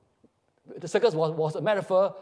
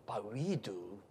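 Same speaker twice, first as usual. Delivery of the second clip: very soft, low final line; tender, almost whispered close; hushed conclusion; 7 semitones lower, 14 dB quieter than usual. Acted. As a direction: let it fade into quiet wonder. Play's hushed closing line.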